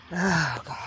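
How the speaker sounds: normal talking voice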